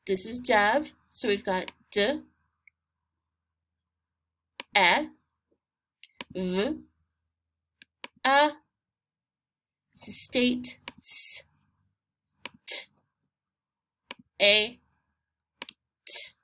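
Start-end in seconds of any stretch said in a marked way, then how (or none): 1.9-8.5 d, e, v, a as separate sounds; isolated phonics sounds d, short e, v, a; sounding out. Shown as a demonstration. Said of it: "Remember to sound those letters out". 11.0-16.3 s, t, long a, t as separate sounds; isolated phonics sounds s, t, a, t; sounding out. Shown as a demonstration. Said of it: "Remember to sound those letters out".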